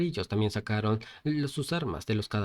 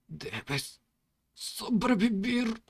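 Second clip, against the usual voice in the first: faint then high strained plea, slow; dying plea; 11 semitones higher, much slower than usual. A narrator's reading. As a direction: beg him to live with the last breath. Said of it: "en sus últimas palabras". (súplica débil de un moribundo)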